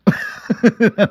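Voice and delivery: high-pitched giggle; quick squeaky laugh. Real, not acted. Laughing at own story.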